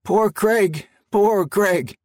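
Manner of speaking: high, grieving moan repeated. A narrator's reading. President kneeling by dead Craig, high grieving moan. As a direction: lament over the fallen guard. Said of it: "he moaned".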